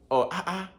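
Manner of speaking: "ah, ah" pitched high; high, short exclamation on "Oh, ah, ah"; meaningful intonation. Shown as a demonstration. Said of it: "intonation is everything".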